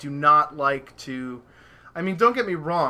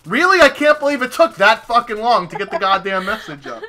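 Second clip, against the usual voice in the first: loud, high incredulous complaint; mock annoyed disbelief; retold exasperation; 5 semitones higher, 7 dB louder than usual. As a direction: start on a high disbelieving yelp, then gripe loudly. Her real reaction to late message.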